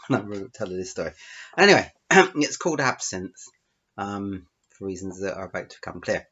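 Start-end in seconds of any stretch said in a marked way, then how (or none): none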